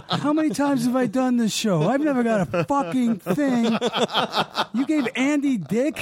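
retold diva tantrum; furious rant. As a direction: rant as the slighted star. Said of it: "he's just furious".

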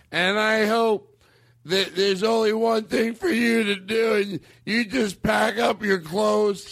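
high, slow mock tirade; angry drunken uncle. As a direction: imitate a drunk uncle ordering someone out. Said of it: "that's the anger".

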